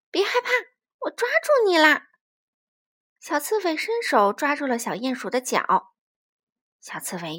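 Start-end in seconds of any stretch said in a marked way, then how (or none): 0.1-2.1 high, raised hedgehog call; high-pitched storybook shout; urgent reassurance. A narrator's reading. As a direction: call out to a frightened friend as you grab hold. Hedgehog grabs mole in gale.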